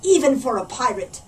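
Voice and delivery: loud pirate lament to his parrot; a booming pirate admitting defeat; pirate captain. A narrator's reading. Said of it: "said Backbeard".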